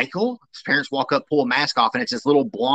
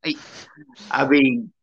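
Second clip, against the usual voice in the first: usual speaking voice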